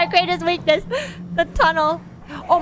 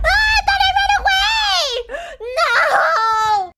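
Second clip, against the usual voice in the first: high shrieking half-laugh, half-cry; panicked shout; 10 semitones higher, slower than usual. Real, not acted. Caught by the monster again.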